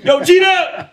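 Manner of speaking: high, loud call. Retold or imitated. Calling Gito into the living room, high and loud. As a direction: shout the name across the room.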